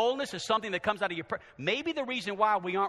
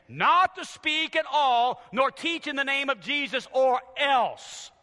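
raised, pointed warning; stern acted order; threatening command; 8 semitones higher, 7 dB louder, slower than usual. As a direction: lay down the law, ending on a threat. Voices the council's threatening command.

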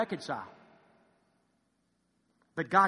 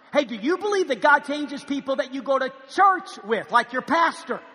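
preaching; 6 semitones higher, 6 dB louder than usual. Acted. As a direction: press the congregation with each repeat. Preaching appeal, repeated question.

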